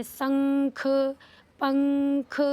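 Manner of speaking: शंख and पंख with long nasal, ख released separately; drawn-out anusvara. Shown as a demonstration. Teacher reading example words to children, drawn-out anusvara. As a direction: sound out शंख, पंख in stretched pieces. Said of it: "इस तरीके के से इसका उच्चारण".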